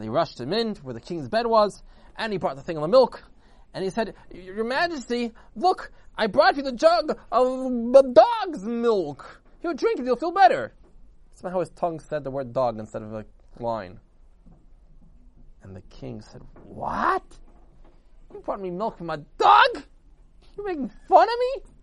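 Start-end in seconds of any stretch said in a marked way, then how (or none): 4.3-10.7 loud, high, drawn-out presentation; slow, booming, high-pitched excitement; excited announcement. A narrator's reading. Messenger proudly presents milk to king. 16.6-21.7 loud, high, incredulous outburst; drawn-out indignant royal shout; outraged king. A narrator's reading.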